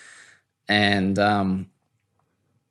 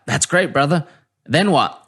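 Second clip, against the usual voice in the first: imitated outgoing Southern guy; higher, upbeat and direct; Booty; 5 semitones higher than usual. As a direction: put on a bubbly, brotherly challenge. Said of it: "and Booty's like".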